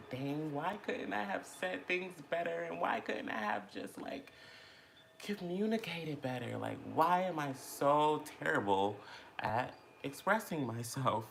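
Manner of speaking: high, pleading self-scolding whine; mock self-pitying whine. Retelling yesterday's moment of beating herself up, high mock whine. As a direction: replay beating yourself up in a whiny voice. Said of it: "I was kinda very down and very hard on myself".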